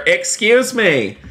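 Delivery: high, drawn-out indignant protest; mock indignation. Streamer confronting the driver who hit the Bentley, mock indignant. Role-play confrontation after game crash.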